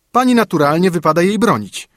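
nanny sneering at the mother; loud unfriendly servant; hostile retort. Acted. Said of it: "nieprzyjaźnie odparła służąca".

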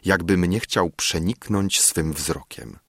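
plain reading voice

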